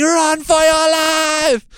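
high, loud, slow hammed-up scream of "Run for your life!". Hazmat-looking crew screaming past the scared couple, high loud mock scream. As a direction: stretch out a mock panicked yell.

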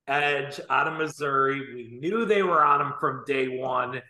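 ordinary talk, nothing marked

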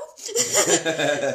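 loud real laugh; burst of laughter. Real, not acted. Laugh after quote.

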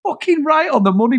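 high, emphatic mock cry of agreement; crying with laughter. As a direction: hail it as the truth, pitched right up. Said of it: "I was fucking crying".

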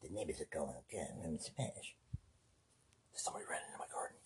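fast hushed whisper. Acted. Setting up the neighbor skit in a fast whisper. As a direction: hiss it so the neighbors won't hear.

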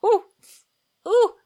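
high, loud swooning ooh; excited ooh. Retold or imitated. Reader swooning at the romance, high excited ooh. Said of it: "and I was like".

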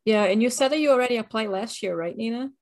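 ordinary delivery

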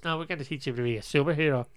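quick, put-on Irish accent; playful Irish accent. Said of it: "You love my Irish accent".

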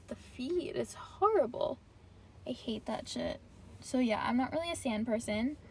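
normal talking voice

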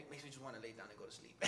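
softly laughing at himself. Real, not acted. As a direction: giggle it out, trailing off. Laughs at own tiredness.

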